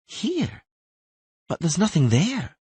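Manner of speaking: put-on a startled girl asking; surprised Ciri. Ciri at the empty fountain, surprised and high.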